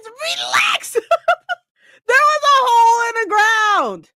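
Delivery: high, loud protest through laughter; exasperated shout. Real, not acted. Protesting to chat that the fall wasn't her fault, a loud exasperated shout. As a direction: yell it in laughing defence.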